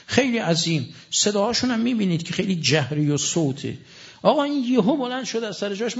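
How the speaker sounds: ordinary delivery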